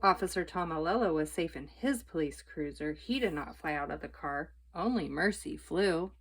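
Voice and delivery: plain reading voice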